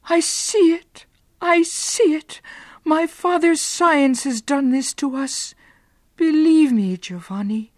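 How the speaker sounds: high, loud frightened cry; screaming. Acted. Beatrice pleading with Giovanni in horror, screaming. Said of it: "Beatrice screamed".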